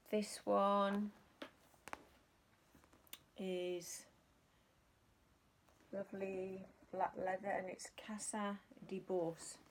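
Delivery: slow, put-on northern French accent. Shown as a demonstration. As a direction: say the brand in a hammed-up French accent. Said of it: "Excuse the terrible accent".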